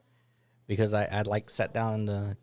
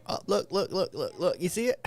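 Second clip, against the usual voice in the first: excited; 8 semitones higher than usual. Real, not acted. Excited about sparkling eyes.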